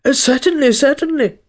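high-pitched, flustered king; lightly muttered, high anxious assent; muttering king. A narrator's reading. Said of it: "the king muttered".

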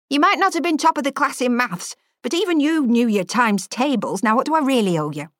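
lightly voiced scolding teacher; a touch of a stern schoolmarm; chiding headmistress. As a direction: tick off an old pupil. Former teacher chides chef.

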